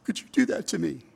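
gasp, then plead as if heartbroken; hammed-up wounded reproach; imitated heartbreak. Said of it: "she was just heartbroken".

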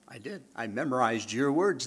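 usual speaking voice

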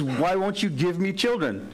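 high, pleading plea; pleading wife. Acted. Hannah begging her husband Elkanah for children. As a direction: beg him for a child.

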